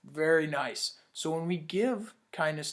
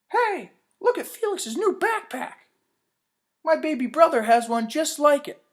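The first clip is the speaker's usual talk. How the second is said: put-on high, loud taunting kid voice; sneering kid, loud and high; mocking classmate; 10 semitones higher, 8 dB louder than usual. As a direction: tease him like a schoolyard bully. Bullies taunting Felix.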